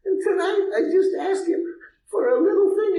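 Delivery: tearful wife. Retold or imitated. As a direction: pour it out like the upset wife. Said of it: "She says".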